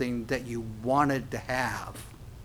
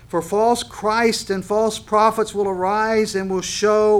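slow, louder, higher scripture read; emphatic reading; 6 semitones higher, 6 dB louder, slower than usual. Shown as a demonstration. Preacher reading the warning verse slowly and loudly. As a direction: weigh each word of the verse. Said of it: "watch the phrase".

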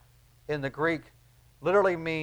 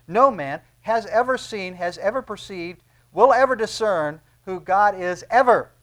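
rising to a loud punch on the final ever; emphatic preaching build; 4 semitones higher, 5 dB louder than usual. Real, not acted. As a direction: hammer each ever louder until the last one rings out.

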